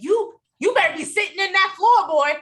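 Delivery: scolding her boy in a put-on West Virginia accent; mock West Virginia accent. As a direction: play a bossy West Virginia mom, half-joking. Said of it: "How'd I do".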